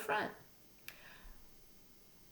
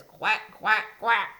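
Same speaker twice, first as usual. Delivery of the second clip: louder ducklike quacks; wood frog call; 5 dB louder, slower than usual. Shown as a demonstration. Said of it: "a wood frog says".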